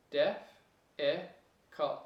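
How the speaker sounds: diff, I, cult split into separate beats; syllable by syllable. Shown as a demonstration. Teacher splitting the word into syllables, spaced out. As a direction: break difficult into three spaced beats. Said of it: "and then you go di".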